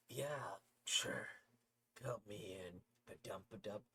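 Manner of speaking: usual speaking voice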